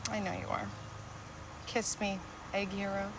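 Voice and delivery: quiet loving reassurance; soft and tender. Acted. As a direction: speak it softly, forgiving. Wife softly forgiving husband.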